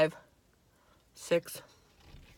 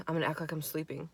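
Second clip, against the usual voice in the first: hushed plan; 4 semitones lower, 12 dB quieter, faster than usual. Retold or imitated.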